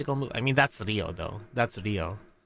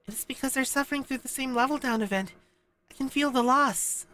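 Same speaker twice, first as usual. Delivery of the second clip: high-pitched character voice; Aris; 8 semitones higher, 4 dB quieter than usual. Acted. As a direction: read it in a much higher put-on voice. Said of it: "oh, this is Aris".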